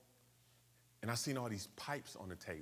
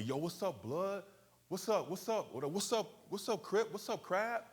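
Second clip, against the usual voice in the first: much higher, rapid-fire hammed-up gang challenge; gang member challenge; 10 semitones higher than usual. Gang members confronting him on the street, high mock challenge. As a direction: fire the what's-ups at him, pitched way up. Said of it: "So they'd come to me".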